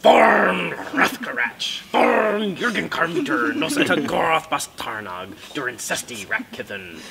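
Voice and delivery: loud, drawn-out Dwarvish rant with rolled uvular R's; very angry dwarf. Acted. Angry dwarf complaining about visitors in the mountains, hammed-up furious roar. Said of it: "it's this very angry dwarf".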